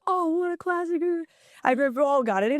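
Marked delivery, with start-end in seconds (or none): 0.1-1.0 imitating fans. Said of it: "people are like".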